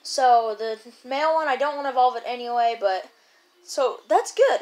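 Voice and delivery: ordinary talk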